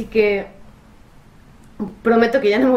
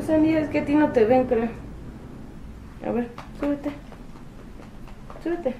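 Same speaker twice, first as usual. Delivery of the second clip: pet-talk coaxing; 5 semitones higher, 4 dB quieter than usual. Real, not acted. Owner coaxes Sandy to climb up, high cooing baby talk. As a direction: sweet-talk the dog, much higher.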